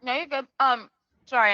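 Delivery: talking while crunching chips. Real, not acted. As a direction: chips crunching between words. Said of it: "I'm eating chips right now".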